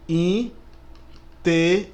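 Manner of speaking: "Inteligíveis" very slowly split into syllables, a pause after each. Shown as a demonstration. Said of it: "Vamos ver". ("Inteligíveis" bem devagar, sílaba por sílaba)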